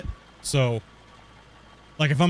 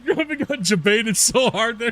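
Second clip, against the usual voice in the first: higher voice breaking into laughter; gleeful laughing; 6 semitones higher than usual. Real, not acted. Prankster laughs at his friend getting baited, gleeful. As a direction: tease him while cracking up.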